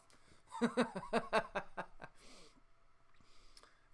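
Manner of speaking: long wheezy, voiceless laugh; sustained breathless laughter; real laugh. Real, not acted. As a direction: crack up after ranting. Laughs after his rant.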